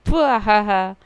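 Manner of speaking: loud laugh. Real, not acted. Laughing at Hao.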